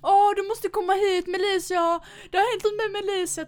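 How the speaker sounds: high, loud, hurried call for help; imitating a panicked host; urgent mock alarm. Host running to wake her friend, urgent mock alarm. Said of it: "sprang han och bara".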